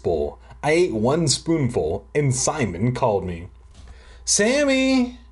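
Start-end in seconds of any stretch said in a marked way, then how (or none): 4.2-5.2 high, mock call from another room; hammed-up yell up the stairs; little brother calling from his bedroom, high mock shout; mock shout from upstairs. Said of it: "he shouted from his bedroom".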